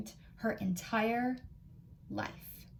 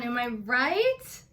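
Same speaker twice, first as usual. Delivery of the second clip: plain reading voice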